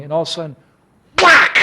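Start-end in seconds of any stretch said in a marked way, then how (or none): 1.2-1.6 loud, high exclaimed whack; imitated slap. Prabhupada suddenly slaps his back, explosive imitated whack. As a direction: burst out the sound of a hard slap.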